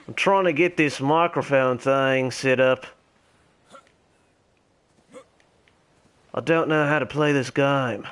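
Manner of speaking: hammed-up, terrible Australian accent; Ringo the Dingo. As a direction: introduce yourself in a broad, badly faked Australian accent. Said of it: "my terrible Australian accent".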